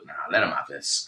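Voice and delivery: soft and low; quiet inner voice. Retold or imitated. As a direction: say it to yourself quietly. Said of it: "I thought".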